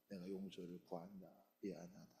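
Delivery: soft, low voice of an apologizing parent; quiet apology. Acted. Parent asking the children's forgiveness after anger, quiet apology.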